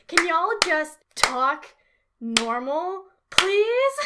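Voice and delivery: slow, loud, word-by-word pleading; fed-up, drawn-out appeal; exasperated plea. Acted. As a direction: beg them, rising loudly on please. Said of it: "and now I'm like".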